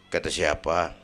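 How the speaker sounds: very low, soft comeback; calm challenge. Retold or imitated. Kiai answering his friend's accusation, low and calm. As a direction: question it quietly, unruffled.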